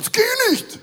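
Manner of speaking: high, loud, fast outraged German; indignant mock German refusal; mock German outrage. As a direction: blurt it out like a scandalised German. Mocking how Germans react.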